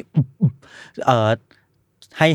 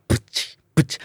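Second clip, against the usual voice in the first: beatboxed kicks with hissy tails; noisy kick imitation; 3 dB quieter than usual. Shown as a demonstration. Podcaster searching similar noisy kicks, imitated drum hits. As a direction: mouth two fuzzy drum hits. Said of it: "กระเดื่องที่มี noise".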